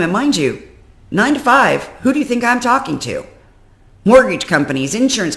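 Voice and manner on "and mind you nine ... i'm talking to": ordinary delivery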